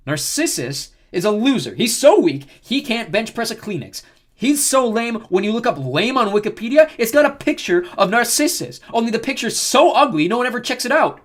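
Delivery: high, loud insult-comic roast; mocking tirade. A narrator's reading. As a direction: pile on the insults for the crowd. Leo roasting Narcissus for crowd.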